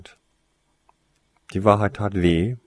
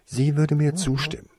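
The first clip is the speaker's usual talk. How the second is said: higher-pitched, confident claim; convinced; 9 semitones higher, 3 dB louder than usual. A narrator's reading. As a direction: state it with full conviction. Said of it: "erklärte Winona überzeugt". (überzeugt)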